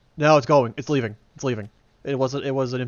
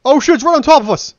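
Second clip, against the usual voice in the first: alarmed yelling, much higher and louder; panicked shout; 11 semitones higher, 10 dB louder than usual. Real, not acted. Tank suddenly right on them.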